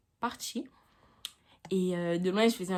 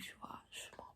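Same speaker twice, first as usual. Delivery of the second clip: whispered aside; 19 dB quieter than usual. Real, not acted.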